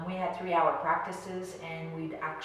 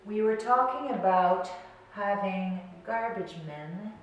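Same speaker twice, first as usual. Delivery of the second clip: slow meditation voice with long pauses; guided meditation; 3 dB quieter, slower than usual. Real, not acted. Coach guiding listeners to breathe and imagine, slow meditation voice. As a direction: lead them gently into stillness, leaving space between phrases.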